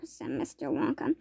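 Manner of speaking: plain reading voice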